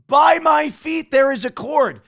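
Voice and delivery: excited shouting. Real, not acted. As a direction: yell with triumphant glee. Celebrating, shouting high.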